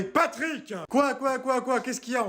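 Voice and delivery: sharp, high-pitched irritated call back; irritated yell. Acted. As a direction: snap back at him from afar. Wife answering husband's call.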